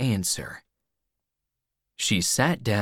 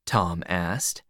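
ordinary delivery

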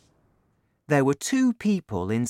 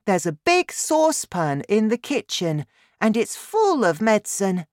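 put-on very high, eager boy; high-pitched excited child; young boy's voice; 9 semitones higher than usual. A narrator's reading. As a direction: pipe up proudly in a boy's voice. Boy eagerly explains to father.

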